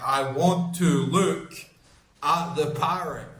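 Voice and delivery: slowly spelled out, word by word; slow, deliberate repeat. Retold or imitated. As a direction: repeat it slowly for someone who didn't understand. Repeats it slowly for uncomprehending man.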